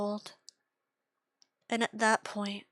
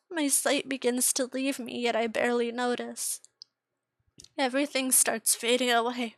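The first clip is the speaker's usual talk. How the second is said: ordinary delivery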